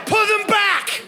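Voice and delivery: high laughing order; mock command. Real, not acted. Comedian scolding Josh's folded arms, high playful order. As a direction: bark it out with a grin. Tells audience to unfold arms.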